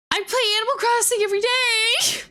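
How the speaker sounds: high, upset whine; distressed wail. Real, not acted. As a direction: wail it in mock-horrified protest. Upset by gruesome hamster story.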